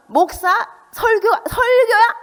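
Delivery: high, loud scoffing at the sermon, drawn out slowly; mocking sneer. Parents in the car mocking the pastor's sermon, high and scornful.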